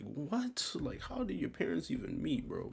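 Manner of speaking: jaw-dropped, can't believe it; stunned by an odd mix of parents; baffled disbelief. Real, not acted. Baffled by mixed ancestry.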